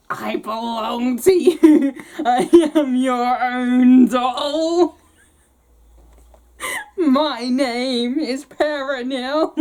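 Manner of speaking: a creepy little doll speaking slowly; talking doll voice. Acted. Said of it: "I shouldn't have done this voice for the doll".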